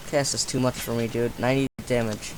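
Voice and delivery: usual speaking voice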